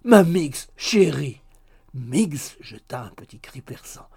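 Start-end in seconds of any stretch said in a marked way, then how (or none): 0.0-1.4 loud, imploring endearment; crying out. A narrator's reading. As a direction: call up to a sweetheart, coaxing. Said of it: "cria monsieur Tapertit". (appel amoureux, fort et implorant)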